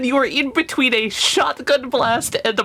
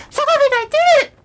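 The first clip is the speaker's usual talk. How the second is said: very high, loud gleeful squeal; triumphant exclamation; 6 dB louder than usual. Acted. Hazel turns to Solomon, loud high triumphant squeal. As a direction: squeal it proudly to a friend. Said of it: "turns to Solomon and is just like".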